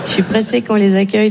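usual speaking voice

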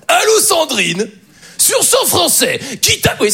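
Jean-Luc Reichmann impression. Coworker [name] imitating a TV host on the phone, slow and high. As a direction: ham up a TV quiz host on the phone. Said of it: "il sait bien faire Jean-Luc Reichmann".

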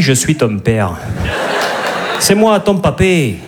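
put-on Darth Vader in a southern French accent, slow on papé; hint of a Provençal Vader; Vader with Midi accent. Comic voicing Darth Vader with the Midi accent, light southern accent. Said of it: "Dark Vador avec l'accent du Midi".

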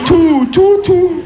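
stunned, repeat it in disbelief; shocked repeat. Retold or imitated. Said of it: "Je lui dis".

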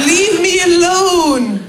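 hammed-up fed-up wail; exasperated plea. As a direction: stretch it out, begging to be left in peace. Acted outburst at cheerful colleague.

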